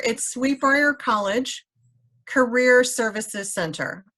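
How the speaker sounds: slow, spelled-out name; slow and clear. Shown as a demonstration. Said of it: "tell us the name of the Facebook group again?".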